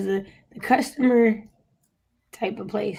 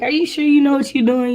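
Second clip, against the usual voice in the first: sharp, louder doubting question; mock disbelief, pitched up; incredulous loud quote; 6 semitones higher, 8 dB louder than usual. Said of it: "I'm like".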